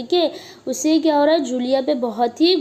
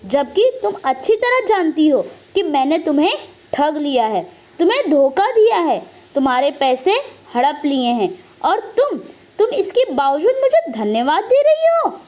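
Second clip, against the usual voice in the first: angry shouting. Acted. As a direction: scold her, building to the question. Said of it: "तेज आवाज़ में उससे चिल्लाते हुए कहता है". (गुस्से में चिल्लाकर डाँटना)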